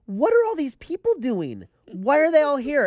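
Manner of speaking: high, incredulous questions; acted bewilderment. Nervous actor baffled by the crowded set, incredulous. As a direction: voice rising in exasperated disbelief.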